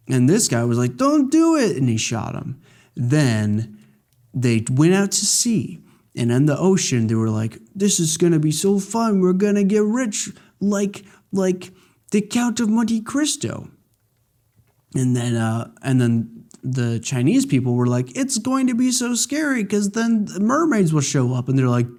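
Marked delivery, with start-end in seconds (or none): 1.0-1.8 very high, squeaky plea; hammed-up high cry of don't; mock plea. Voicing a pleading crewman. 7.7-13.7 high, giddy put-on voice; lightly hammed-up eager crew; mock excited sailors. 18.2-21.5 high, fretful put-on; lightly hammed-up worry; mock scared voice. Voicing frightened sailors.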